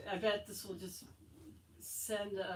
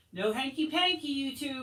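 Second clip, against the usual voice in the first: teasing call-out; 8 semitones higher, 8 dB louder than usual. Acted. Boy calling out teasing.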